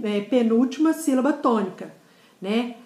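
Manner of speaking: ordinary delivery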